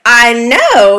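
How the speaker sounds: proudly emphatic agreement. Retold or imitated. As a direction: own the compliment with punch. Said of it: "instead of an emphatic".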